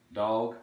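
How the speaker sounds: "Dog" with a wide drawn vowel; Texan pronunciation. Shown as a demonstration. Teacher demonstrating Texan, "Dog" with wide vowel. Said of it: "Quite a wide vowel for dog".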